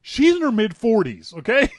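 can barely get it out for laughing. Real, not acted. Amused that a grown woman rebels, laughing.

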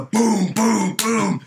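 loud, punched booms; imitated slamming. Imitating the cook slamming his hand, loud booms. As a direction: boom it out like a hand pounding.

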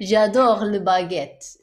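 hammed-up French chef voice. Joking French phrases.

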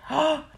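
sharp gasp of disbelief; quick gasp at the news; shocked gasp. Real, not acted. Shocked by the fire pool.

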